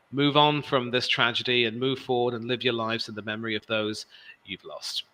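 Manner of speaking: ordinary talk, nothing marked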